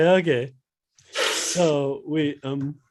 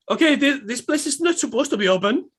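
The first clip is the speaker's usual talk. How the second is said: startled man; 9 semitones higher, faster than usual. Acted.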